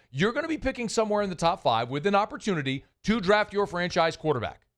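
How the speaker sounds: normal talking voice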